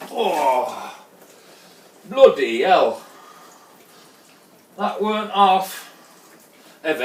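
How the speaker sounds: loud put-on Yorkshire accent. Brewer reacting to the heavy load, put-on Yorkshire accent. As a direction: exclaim it in a mock Yorkshire accent. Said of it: "trying to do a flipping Yorkshire accent".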